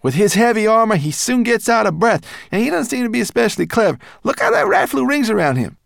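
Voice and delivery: high, loud, proud creature voice; Berber Chan's voice. Acted. Guide showing off the moonstone walls, high and proud. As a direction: boast about the tunnel walls.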